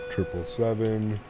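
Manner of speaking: ordinary talk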